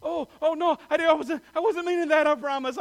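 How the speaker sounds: high, loud panicked backpedal; flustered apology. Retold or imitated. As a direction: scramble to take it back, mortified. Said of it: "I'm going".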